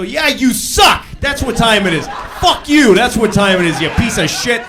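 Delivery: loud, high hostile outburst; abusive shouting. Acted. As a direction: yell abuse at someone asking the time. Acted abuse at a time-asker.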